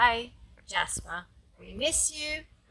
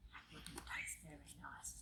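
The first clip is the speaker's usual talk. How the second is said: very low, barely audible murmur; hushed aside; 22 dB quieter than usual. Real, not acted. Grandma to grandchild about the story title, hushed aside.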